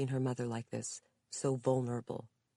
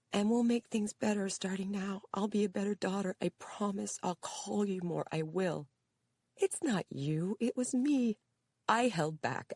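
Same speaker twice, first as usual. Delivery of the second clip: put-on high, pleading young woman; earnest daughter; 7 semitones higher than usual. A narrator's reading. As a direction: promise her with rising emotion. Daughter promises tearfully.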